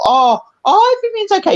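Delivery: high, sing-song mock reassurance; mocking fake-nice. Mocking the people who pretend all is fine, high sing-song. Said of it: "these good people".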